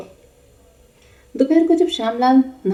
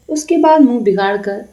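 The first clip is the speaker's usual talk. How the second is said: ordinary delivery, nothing marked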